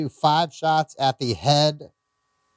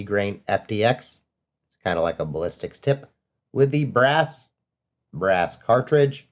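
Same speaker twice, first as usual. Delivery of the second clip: lower, even voiceover reading out the test results; 5 semitones lower than usual. Acted. Host recaps ammo velocity test, steady voiceover read. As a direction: read the numbers like a calm recorded narration.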